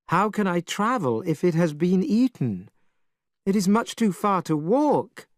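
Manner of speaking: a hint of tears in the voice; mild whining cry. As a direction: complain like a tearful priest. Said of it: "cried Tripitaka".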